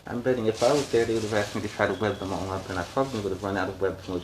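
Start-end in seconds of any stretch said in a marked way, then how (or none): none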